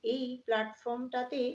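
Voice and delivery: ordinary delivery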